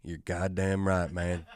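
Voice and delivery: put-on a muttered drunk's smug reply; smug low drunk. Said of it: "he goes".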